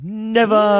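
high, loud dramatic cry; hammy stage actor. Retold or imitated. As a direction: declaim it like a stage hero. Quoting dramatic stage line.

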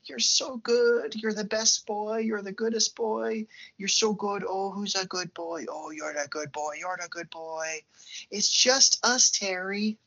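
put-on high, cooing baby-talk mom; doting mom. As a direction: coo over him like a crazed mother. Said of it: "is like".

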